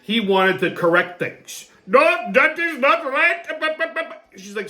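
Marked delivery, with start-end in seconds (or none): 1.9-3.5 slow, high mock Indian accent correcting people. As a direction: imitate the old Indian doctor, slow and much higher. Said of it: "this fucking Indian guy kept interrupting".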